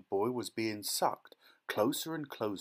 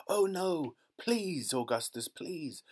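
cried out; 6 semitones higher, slower than usual. Acted. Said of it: "he cried out".

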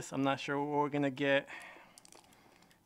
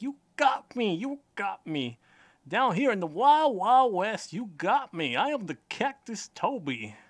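high, loud cowboy toy voice; cactus toy voice; 7 semitones higher, 4 dB louder than usual. Pretend. Vlogger voices the cactus toy for viewers. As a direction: play the toy cactus boasting in the Wild West. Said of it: "I'm not sure if he talks like that".

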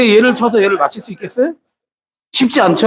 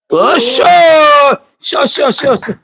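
cheering on; 8 semitones higher, 3 dB louder, much slower than usual. Real, not acted. Cheering the ball on.

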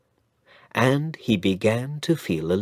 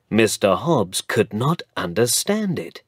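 ordinary delivery

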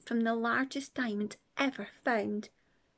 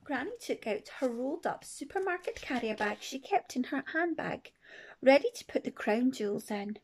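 usual speaking voice

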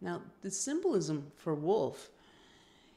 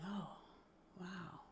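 hushed awe; 15 dB quieter than usual. Acted. Grandson absorbing his grandfather's words, hushed and awed. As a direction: take it in quietly, almost to yourself.

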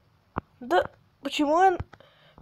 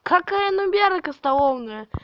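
indignant retort; 6 semitones higher, 4 dB louder than usual. Real, not acted. Reads insult from chat.

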